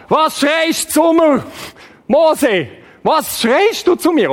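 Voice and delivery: high, loud, slow shouted rebuke; booming rebuke. Acted. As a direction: thunder it down at him. God rebukes Moses loudly.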